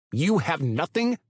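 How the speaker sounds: loud, incredulous shout. A narrator's reading. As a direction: yell it in disbelief. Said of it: "Witter practically shouted".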